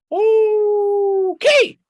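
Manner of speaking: high, loud, drawn-out rising okay; eager Rabbit. Acted. Rabbit happily agrees to Fox's plan, high and eager.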